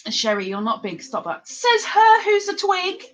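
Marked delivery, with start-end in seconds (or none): 1.5-3.1 high, drawn-out teasing protest; teasing retort. Real, not acted. Teasing Sherry for calling her small, high mock protest. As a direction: throw it back in a high slow voice.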